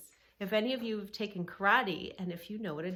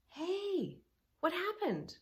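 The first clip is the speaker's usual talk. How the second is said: concerned brother; 11 semitones higher, slower than usual. Acted. Brother rushing to sister's side.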